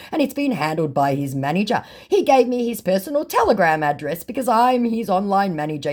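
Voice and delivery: hammed-up fake manager; mock fake manager voice. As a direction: gush like a fawning fake manager. Reading fake manager message.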